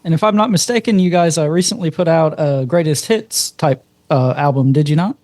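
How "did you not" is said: ordinary talk, nothing marked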